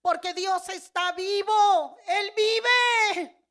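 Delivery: loud, high, drawn-out tearful shout; anguished cry. Retold or imitated. Woman crying out that God is alive, anguished shout. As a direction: cry it out at full volume. Testimony, retold defiant cry to someone.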